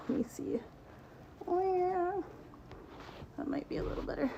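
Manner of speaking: whispered under her breath, checking the camera; muttered to self. Real, not acted. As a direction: mutter it quietly to yourself.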